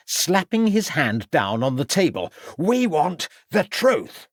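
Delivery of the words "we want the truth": slow, high, forceful demand building to a shout; demanding detective. Acted. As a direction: slap the table and demand the truth. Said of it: "demanded Ravenscroft".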